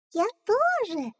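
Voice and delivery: chick voice. Acted. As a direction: squeak it like a newborn chick. Chick echoes.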